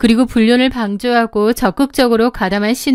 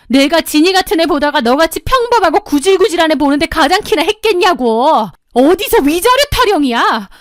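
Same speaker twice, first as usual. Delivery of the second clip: contemptuous ranting; 5 semitones higher than usual. A narrator's reading. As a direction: spit insults down at her. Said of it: "눈을 부라리며 저에게 막말을 쏟아냈습니다". (눈을 부라리며 막말을 쏟아내는 목소리)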